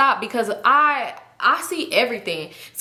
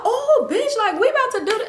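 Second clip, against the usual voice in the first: high-pitched gleeful squeal of a quote; excited friend; 13 semitones higher than usual. Retold or imitated. Friend reacts to found money, imitated high excitement.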